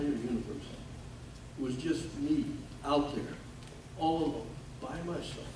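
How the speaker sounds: very soft, with long pauses; quiet, halting recollection of being all alone; hushed loneliness. Real, not acted. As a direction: let the words drop away into stillness. Recalling being left alone in enemy territory.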